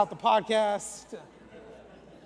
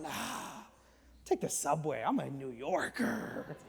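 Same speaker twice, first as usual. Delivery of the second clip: mock cocky; 5 semitones lower, 7 dB quieter than usual. Cocky reply retold as a joke.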